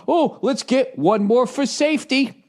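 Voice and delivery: high, slow mock-helpful voice; douche. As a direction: imitate an annoying helper, drawing it out high. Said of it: "He fucking takes one".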